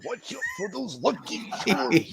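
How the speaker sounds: high, gravelly-free wrestling promo voice with a long drawn ooh yeah; Randy Savage growling about cereal; Randy Savage impression. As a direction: hype it like a pro wrestler cutting a promo. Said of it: "we need some Randy Savage. Can you do it?".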